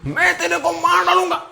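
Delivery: very high, put-on giggly threat; mock laughing threat. Shown as a demonstration. Teacher showing a threat made while laughing, high mock-laughing voice. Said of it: "हंसते हंसते".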